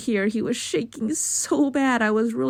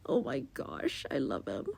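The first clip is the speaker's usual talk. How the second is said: whispering; 4 semitones lower, 11 dB quieter than usual. Real, not acted. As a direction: breathe it out in adoration. Adoring whisper.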